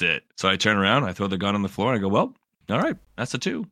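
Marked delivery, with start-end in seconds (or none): 2.1-3.7 high, light mock-resigned concession; resigned acceptance. Survivor throws gun down, high-pitched resigned acceptance. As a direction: act out giving in with a shrug. Giving in after companion is shot.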